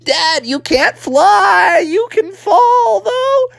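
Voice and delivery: put-on high, drawn-out kid wail; alarmed frog son. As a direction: call slowly down to falling Dad. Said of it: "downwards, like".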